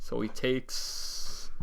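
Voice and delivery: drawn out, very slow; slow dramatic pause. Acted. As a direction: drag it out for suspense. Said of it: "Go ahead. You got it".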